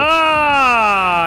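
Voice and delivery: loud high yelp; startled yell. Real, not acted. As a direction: cry out in surprise. Startled in game.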